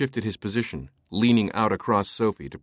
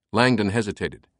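ordinary delivery, nothing marked

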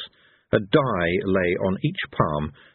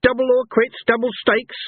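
high, raised-voice angry goading; dancing with rage; 13 semitones higher, 4 dB louder than usual. A narrator's reading. Cohen challenges Fate to double stakes, enraged. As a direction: goad him furiously, pitch leaping up. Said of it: "dancing with rage".

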